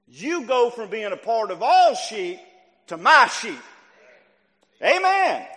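loud, emphatic pulpit delivery; sermon climax capped by a ringing Amen; full-force preaching. Real, not acted. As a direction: drive the point home phrase by phrase.

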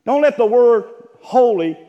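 normal talking voice

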